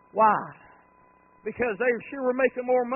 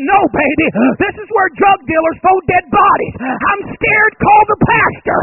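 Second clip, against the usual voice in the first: loud, higher, hammed-up panic; mock scared shout; 11 semitones higher, 14 dB louder than usual. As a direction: pretend terror at the empty lot. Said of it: "I said".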